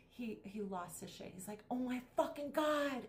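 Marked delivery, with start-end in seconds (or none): 1.7-3.1 mock angry outburst. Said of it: "he was so angry".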